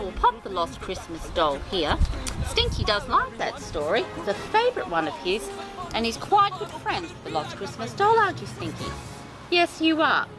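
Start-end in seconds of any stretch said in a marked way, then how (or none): none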